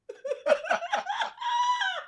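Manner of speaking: high, shrieking burst of laughter. Real, not acted. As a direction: let the laugh explode. Laughing fit continues.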